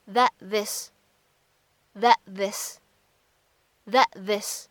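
"that this" with the t of "that" held back, not released; incomplete plosion. Shown as a demonstration. Teacher demonstrating incomplete plosion on that this. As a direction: swallow the t in "that" before "this". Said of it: "不完全爆破的现象".

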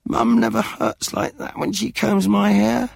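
put-on high, whiny little boy; complaining child. A narrator's reading. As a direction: protest to Dad. Kipper complaining to Dad.

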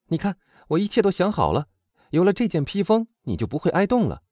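put-on high, animated young woman's voice; lively storybook heroine, high and eager; confident young heroine. A narrator's reading. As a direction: reassure a friend with a plan, pitched high.

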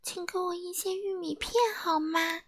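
soft, high, tiny voice; timid small voice. A narrator's reading. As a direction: speak up very quietly and meekly. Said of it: "安静先生小声地说". (又轻又细地小声说)

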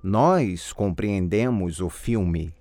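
ordinary talk, nothing marked

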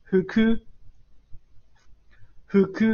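呼哭 stepping up in pitch; short 呼哭, lifted on 哭; rising pitch accent. Shown as a demonstration. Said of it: "往上读哈".

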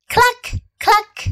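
high clucking hen. Acted. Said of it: "squawked the hen".

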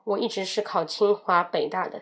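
ordinary talk

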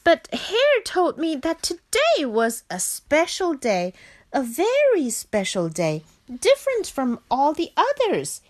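a desperate frog protesting; shouting. Acted. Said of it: "he shouted".

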